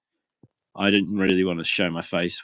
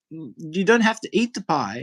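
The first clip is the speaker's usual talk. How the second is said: higher teasing tone; mock innocent; 6 semitones higher than usual. Server teasing the inmate about the pie, innocent tone. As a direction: play it innocent to wind him up.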